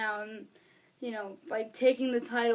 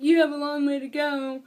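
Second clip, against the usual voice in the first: mock rudeness; 2 semitones higher, 6 dB louder than usual. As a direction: imitate a wrestler rudely dismissing his rival. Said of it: "grabs it rudely and was like".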